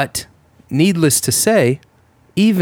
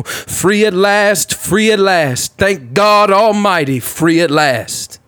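slow, soaring, higher preacher cry; MLK impression; 7 semitones higher, slower than usual. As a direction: stretch every word like King's finale. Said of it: "That's our boy M L K".

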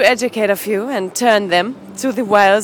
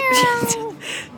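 cat-like yowl; 12 semitones higher, 6 dB quieter than usual. Acted. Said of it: "You sound like a cat".

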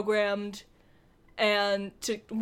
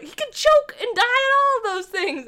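much higher, louder put-on voice; Leia mocking; 13 semitones higher, 7 dB louder than usual. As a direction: squeak it out like a fussing mom. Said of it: "And Leia's like".

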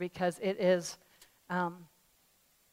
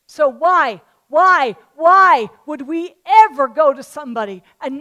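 loud rhetorical challenge; 5 semitones higher, 13 dB louder than usual. Acted.

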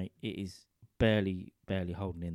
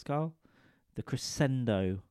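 ordinary talk

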